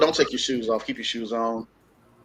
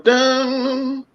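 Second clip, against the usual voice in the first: usual speaking voice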